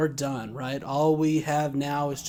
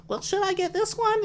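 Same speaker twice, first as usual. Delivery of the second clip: much higher put-on old lady voice; quavery granny pestering the clerk; old lady customer. Customer quoted asking the clerk in a high old-lady voice. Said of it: "And she goes".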